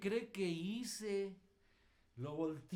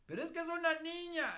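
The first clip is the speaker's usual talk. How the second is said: high, loud whiny protest; exasperated young man's high complaint; whiny child self; 10 semitones higher, 5 dB louder, faster than usual. Retold or imitated. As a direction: mimic your younger self whining, pitched way up. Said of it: "Yo decía".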